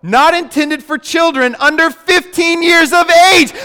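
high, loud hammed-up label reading; mock warning label. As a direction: read the warning like it's absurd, rising in outrage. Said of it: "it goes".

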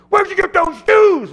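very high, full-force heckling yell; upset gruff shout. Retold or imitated. Gruff man demanding to know about the MC's shoes, upset shout. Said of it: "he's getting more and more upset".

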